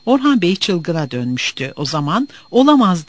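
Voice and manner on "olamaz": higher, louder, emphatic exclamation; outraged cry. A narrator's reading. Orhan Bey reacting to news of his student, outraged cry. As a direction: cry out in disbelief, moderately forceful. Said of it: "diye haykırmıştı".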